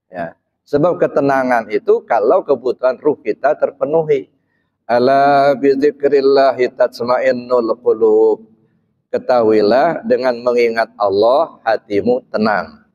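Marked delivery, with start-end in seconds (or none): 4.9-8.5 slow, measured Arabic recitation; slow scriptural quotation in Arabic; Quran recitation. Real, not acted. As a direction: recite the verse slowly and evenly.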